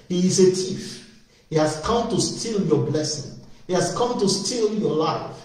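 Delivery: ordinary talk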